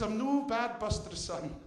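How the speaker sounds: put-on high-pitched Scottish old lady; Scottish accent. Old lady answering her young visitor, Scottish accent. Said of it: "I apologise for the accent".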